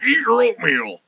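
mock yell, pitched well up; Wilford Brimley yelling. Co-host joking about an actor wandering across the set, mock yelling. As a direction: holler it like a comic impression. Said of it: "now and then yelling".

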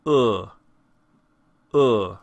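short "Uh" as in book; "Uh" with the lip corners tucked in; horseshoe vowel. Shown as a demonstration. Teacher demonstrates tucked lip corners, short isolated "Uh" as in book. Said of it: "tucking in those corners of our mouth".

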